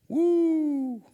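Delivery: high, loud whoop of delight; excited whoop. Real, not acted. Pastor cheering the believers with a high loud whoop. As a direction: let out a big high "woo".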